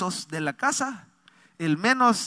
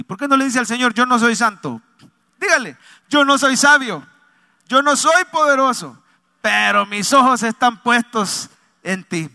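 high, loud call to repeat; emphatic pulpit declaration in separate phrases; fervent preaching; 6 semitones higher, 8 dB louder than usual. Acted. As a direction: lead the church in declaring it. Leads congregation in declaration.

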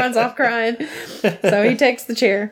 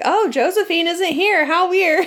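high, singsong put-on puzzlement; mock girls' voice; 8 semitones higher than usual. Girls finding the hotel room empty, high mock-puzzled voice. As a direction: pitch it up in airy mock surprise. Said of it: "And they're like".

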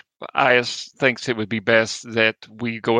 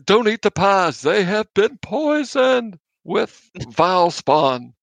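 higher, slow proclamation; mock booming scream; 6 semitones higher, slower than usual. Player warns festival crowd by thaumaturgy, slow mock booming cry. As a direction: boom a pretend warning across the crowd, drawn out. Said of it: "Your voice booms up to three times as loud".

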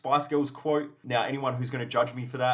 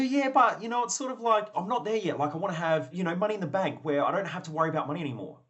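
higher-pitched imitation of an unconvinced client; hedging client; 4 semitones higher than usual. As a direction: push back in a raised voice, easing down gradually. Hedging objection in retold coaching dialogue.

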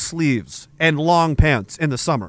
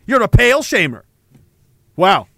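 normal talking voice

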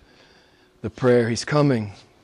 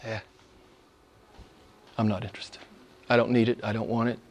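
dismissive shrug; 12 semitones lower, 11 dB quieter, much faster than usual. Retold or imitated. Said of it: "and they say".

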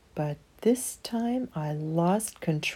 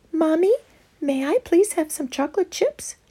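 put-on child asking; 10 semitones higher than usual. Emma pleading with mommy.

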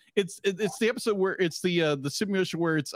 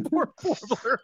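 laughing pity; 6 semitones higher than usual. Real, not acted. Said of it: "Oh, poor guy".

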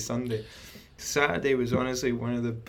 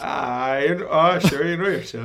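teasing Irish lilt, pitched up; mock Irish accent; 2 semitones higher, 5 dB louder than usual. Guest echoing host's Irish catchphrase, mock Irish accent. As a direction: parrot it in a playful Irish accent. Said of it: "you're so fucking Irish at some".

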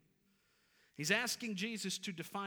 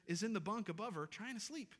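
ordinary delivery

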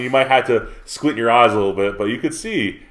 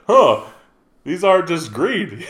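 mimicking a kid; 8 semitones higher, slower than usual. Mimicking a confused kid on plane.

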